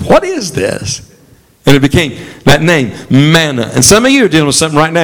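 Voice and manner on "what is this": high, puzzled what-is-this; retelling the Israelites' baffled question; bewildered question. Retold or imitated. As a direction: ask it as if seeing something strange. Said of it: "they said".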